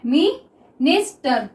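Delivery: drawn out slowly; dictating a word slowly to students; slow dictation. Shown as a demonstration. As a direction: spell it out in sound while writing. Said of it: "देखिए minister".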